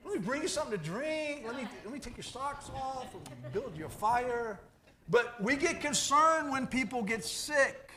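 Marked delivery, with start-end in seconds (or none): 0.0-4.6 high, sweet doting wife voice; imitating wife. Wife fussing over her feverish husband, sweetly cooing. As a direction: coo like a wife babying her sick husband.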